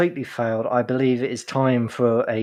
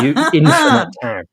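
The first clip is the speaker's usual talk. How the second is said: teasing insult; 5 semitones higher, 5 dB louder than usual. Real, not acted.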